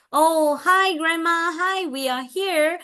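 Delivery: high, drawn-out friendly hello; modeled greeting. Acted. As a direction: greet grandma for a child to copy.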